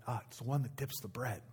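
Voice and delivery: very soft, low-key aside; hushed relay. Acted. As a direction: pass it on discreetly to a neighbor. Disciple relaying answer privately to another.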